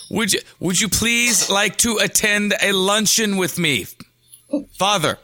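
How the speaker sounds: high, formal, over-enunciated impression; stiffly polite Malkovich-style invitation; that stilted voice. Inviting Dad to lunch on request, tensely formal. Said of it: "say it in that voice".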